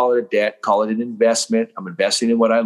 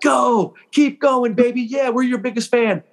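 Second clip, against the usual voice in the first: high, hammed-up cheering from the sidelines; a mock fan's sudden high cheer; 13 semitones higher, slower than usual. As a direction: play the doubter turned superfan, cheering. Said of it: "they'll be like".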